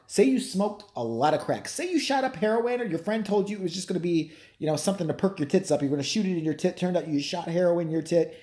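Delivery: usual speaking voice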